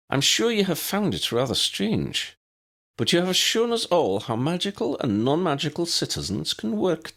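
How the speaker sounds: high, prim Scottish headmistress voice; brisk, proper elderly professor; McGonagall. A narrator's reading.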